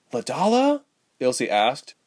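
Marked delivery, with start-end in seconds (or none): 0.1-0.8 high, rising startled question; much higher voice, questioning; surprised query. A narrator's reading. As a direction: seize on the name in surprise. Sudden query on hearing name.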